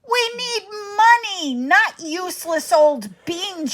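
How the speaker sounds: the angry mother cries out; furious mother. Acted. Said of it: "she cried".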